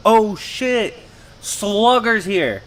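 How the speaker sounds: high, pleased surprise at a familiar name; light retold gasp of surprise; a voiced double take, not a real one; surprised recognition. Ben surprised at old friend.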